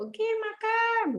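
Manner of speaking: very high, drawn-out singsong; slow, high, sung-out mealtime call; playful announcement. Retold or imitated. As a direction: call a child to eat, stretched and lilting. Announcing mealtime to fasting child.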